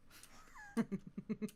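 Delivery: squealing giggle; high squeaky giggle. Real, not acted.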